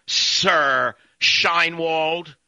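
very slow, sneering "Sir"; stretched sarcastic address; mocking title. Real, not acted. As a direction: drawl the title out with contempt.

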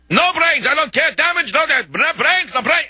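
very high-pitched ranting of an enraged Russian; mock Russian screaming. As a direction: imitate a livid Russian shrieking in broken English, without extra volume. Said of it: "he's on and on with the no brains".

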